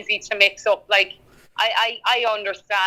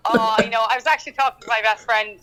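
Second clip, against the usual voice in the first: ordinary talk, nothing marked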